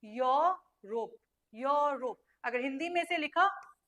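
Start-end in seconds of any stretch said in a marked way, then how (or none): 0.0-2.2 slow, stretched "यूरोप" as "yaw... rop"; "यूरोप" drawn out with an open o first; correct pronunciation demo. Shown as a demonstration. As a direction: model "यूरोप" syllable by syllable, starting "yo". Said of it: "it is Europe".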